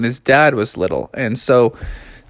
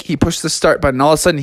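plain reading voice

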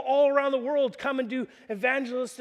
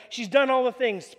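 ordinary talk, nothing marked

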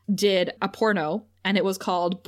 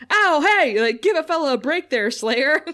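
higher and louder fake ouch; mock pain; 6 semitones higher, 5 dB louder than usual. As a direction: ham up a pretend hurt. Spike pretending it hurts.

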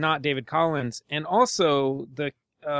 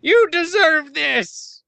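slow, high, loud yell; mock shout; 12 semitones higher, 6 dB louder, slower than usual. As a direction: yell like a vengeful grown-up. Mock yelling at bullied kids.

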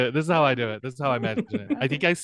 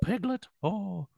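Winnie the Pooh voice; 6 semitones higher, 5 dB quieter than usual. Retold or imitated. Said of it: "Nah, I've lost it".

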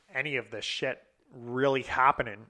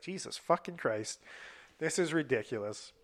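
ordinary talk, nothing marked

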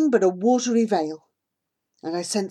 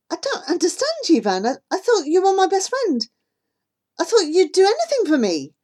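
hurt protest; 12 semitones higher, 5 dB louder than usual. Acted.

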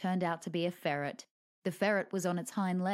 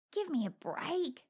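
higher groaned complaint; groaning; 8 semitones higher than usual. Acted. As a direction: groan it out as you land. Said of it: "he groaned".

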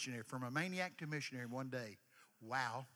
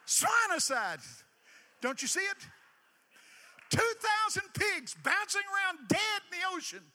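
preaching shout; 10 dB louder than usual. Real, not acted.

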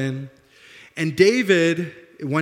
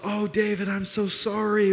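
apologetic; 7 semitones higher than usual. Acted. Saul repenting to David, apologetic. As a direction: act out a gushing sorry. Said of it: "he kind of repents and apologizes".

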